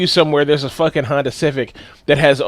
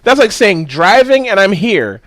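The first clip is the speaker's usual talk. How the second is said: ordinary delivery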